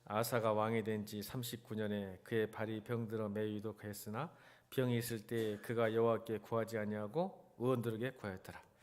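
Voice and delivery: ordinary delivery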